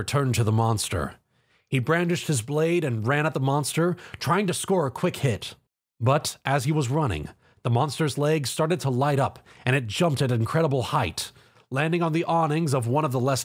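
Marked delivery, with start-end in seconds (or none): none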